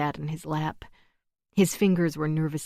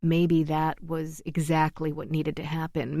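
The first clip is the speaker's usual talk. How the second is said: ordinary delivery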